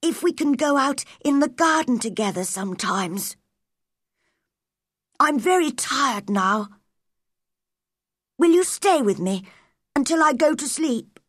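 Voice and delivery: lightly voiced tired boy asking for company; Colin, wistful sick boy. A narrator's reading. Sick boy asks girl to stay, wistful.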